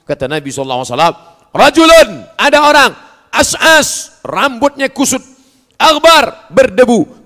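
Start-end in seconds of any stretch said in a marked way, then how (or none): 1.5-7.2 preaching shout. Acted. Hadith recited with force.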